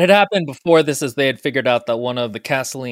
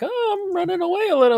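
high, breezy fake innocence; lightly voiced sneaky escape, much higher; mock nonchalant. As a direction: play it cool as a cucumber. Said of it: "really chill, cool as a cucumber".

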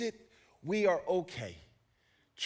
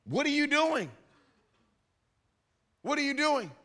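higher, startled challenge; mock alarm; 7 semitones higher than usual. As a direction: call out at a thief. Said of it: "the people say".

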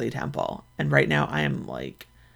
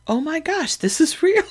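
much higher, thrilled realisation; excited disbelief; 13 semitones higher, 3 dB louder than usual. Retold or imitated. Podcaster learns nomination is real, high thrilled squeal. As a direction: squeal it like you just found out. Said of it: "And I was like".

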